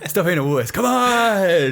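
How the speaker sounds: hammed-up drill-sergeant push to snap out of it; mock tough coach. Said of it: "you can't come up and go".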